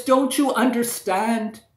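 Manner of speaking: high and loud, pleading; anguished cry. Acted. Princess crying down to the prince about her lonely fate, loud lament. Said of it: "cried out the princess".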